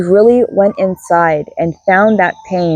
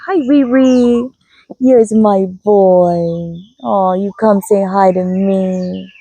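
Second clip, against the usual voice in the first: cooing at a pet; much slower than usual. Real, not acted. Owner cooing slowly at her dog who came to say hi.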